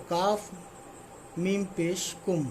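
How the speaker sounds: काफ मीम पेश कुम said slowly, letter by letter; slow spelling demo. Shown as a demonstration. Teacher slowly spelling कुम for students. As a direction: drag out each letter name for learners.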